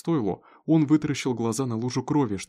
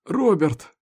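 high, loud call to his son; pained cry; 8 semitones higher, 6 dB louder than usual. A narrator's reading. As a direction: cry the name out in pain. Injured father cries out to son. (болезненный оклик)

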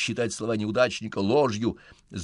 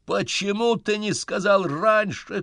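loud high anguished shout; 13 semitones higher, 7 dB louder, slower than usual. A narrator's reading. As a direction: yell it in alarm. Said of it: "кричу я".

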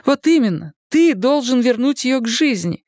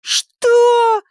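high, loud, stretched cry; howling; 13 semitones higher, 4 dB louder than usual. A narrator's reading. Daughter hears her plans are cancelled, howling. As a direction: wail in outraged disbelief. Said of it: "взвыла Дакота".

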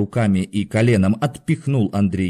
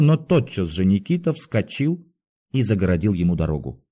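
usual speaking voice

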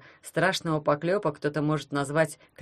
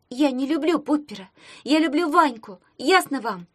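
higher and louder, protesting; loud insistence; 8 semitones higher, 4 dB louder than usual. A narrator's reading. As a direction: shout to convince the empty room. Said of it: "громко сказала Таня".

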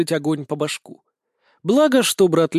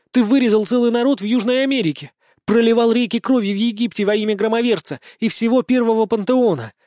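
heated, higher-pitched angry tirade; hissed accusation; 6 semitones higher, 4 dB louder than usual. A narrator's reading. Sister accusing her brother, angry high hissing. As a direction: hurl the charges at them furiously. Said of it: "шипела Лина".

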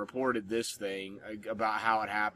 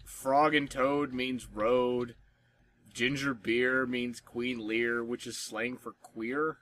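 slow reading; slower than usual. Real, not acted. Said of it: "this is fucking confusing".

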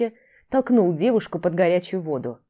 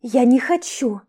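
stubborn refusal; 6 semitones higher, 5 dB louder, slower than usual. Acted. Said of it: "продолжала упираться та".

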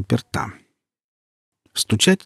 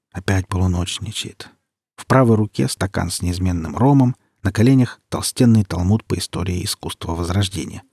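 usual speaking voice

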